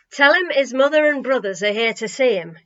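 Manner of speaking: loud, bossy order; commanding. A narrator's reading. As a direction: bark out the order. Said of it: "commanded the man".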